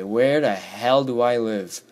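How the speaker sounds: usual speaking voice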